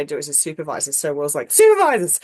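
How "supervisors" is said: high, loud call to action; rallying shout. Retold or imitated. Husband sending the family running to supervisors, a loud rallying shout. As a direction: bark it like a battle cry.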